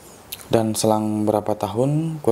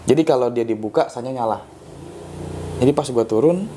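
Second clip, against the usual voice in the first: usual speaking voice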